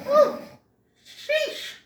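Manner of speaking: sudden high squealed ooh, sheesh. Retold or imitated. Imitating a character's high disgusted squeal.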